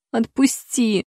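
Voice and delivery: pleading, whining plea; plaintive. A narrator's reading. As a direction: beg pitifully. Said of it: "повторила я на этот раз жалобно".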